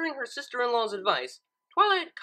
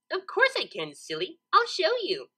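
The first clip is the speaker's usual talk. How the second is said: higher, playful, light giggle in it; giggly; 8 semitones higher than usual. A narrator's reading. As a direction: tease her friend. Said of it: "Pinkie giggled".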